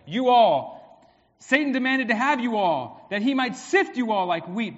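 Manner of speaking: "you all" punched out loud each time; loud, driving reading that hits "all" hard; emphatic plural reading. Shown as a demonstration. Preacher showing Jesus addresses all disciples, "you all" stressed loudly. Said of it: "So now you read that".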